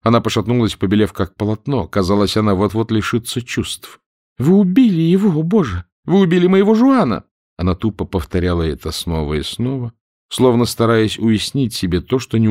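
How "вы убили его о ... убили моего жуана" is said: high, louder despairing cry; a woman's grief breaking out, loud and high; woman grieving for her slain lover; anguished. A narrator's reading. Woman learns her lover is dead.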